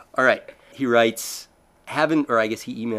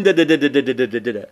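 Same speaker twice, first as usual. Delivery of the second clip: rapid imitation of crank-started machinery; fast rattling motor imitation; cranking-engine noise; 4 dB louder, faster than usual. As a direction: sputter like an old machine being cranked up. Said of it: "you have to crank it up".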